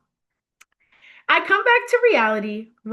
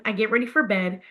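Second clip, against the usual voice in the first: usual speaking voice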